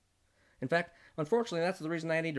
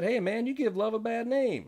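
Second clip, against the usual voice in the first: higher, louder taunt; three-piece sucker; 9 semitones higher, 5 dB louder than usual. Retold or imitated. The suit-wearing sucker taunts the narrator, loud and high. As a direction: jeer it at him up high and loud. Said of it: "came in and said".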